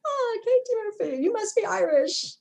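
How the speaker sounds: teacher on the first day. Retold or imitated. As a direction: chirp it like a cheery teacher taking roll. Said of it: "was like".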